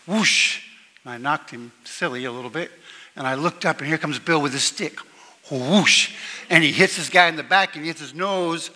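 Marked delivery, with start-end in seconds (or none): 0.1-0.6 a quick, loud, high whoosh of a hockey swing; swinging stick whoosh. Retold or imitated. Imitating his own hockey stick swing, loud whoosh. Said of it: "and I went". 5.5-6.1 stick swing whoosh. Retold or imitated. Bill swings his stick.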